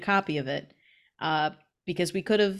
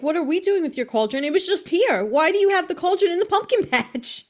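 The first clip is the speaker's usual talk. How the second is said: higher, louder, quick indignation; indignant boys; 10 semitones higher, 5 dB louder than usual. Acted. Boys argue back at the old lady, indignant. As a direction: throw the question back, offended.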